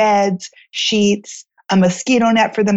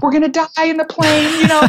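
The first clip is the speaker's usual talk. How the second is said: high-pitched comic fretting; hammed-up fear of disaster; mock panic; 8 semitones higher than usual. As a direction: play the panicking wife for laughs. Acts out panic before the trip.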